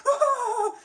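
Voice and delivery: very high cartoon giggle; Shaggy giggle. Shown as a demonstration. Shaggy voice demo ends in a high goofy giggle. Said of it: "that's how I do the voice of Shaggy".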